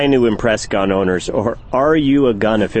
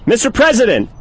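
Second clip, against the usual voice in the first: raised, insistent call; 12 semitones higher than usual. Acted. Calling louder, unanswered.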